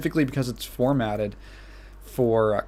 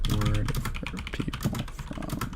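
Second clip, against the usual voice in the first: under the breath, unvoiced; whispering to self; 3 semitones lower, 7 dB quieter, slower than usual. Real, not acted. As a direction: mutter it to yourself. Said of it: "muttering to self".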